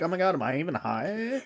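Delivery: light Valley Girl impression; ditzy Valley Girl question. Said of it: "That was a great Valley Girl".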